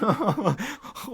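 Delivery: sudden loud high laugh; high burst of laughter. Real, not acted. Friend cracking up at the joke, loud high laugh.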